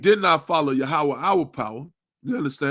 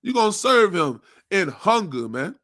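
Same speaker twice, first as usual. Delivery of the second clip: preaching at full force; 3 semitones higher, 4 dB louder than usual. Real, not acted. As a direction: hammer each word like a street preacher.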